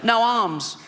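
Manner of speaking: put-on funny little high, slow child voice; strained little kid's croak; Timmy. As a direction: play a small boy with a bad chest. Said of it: "a funny little voice 'cause his all, chest is all fucked".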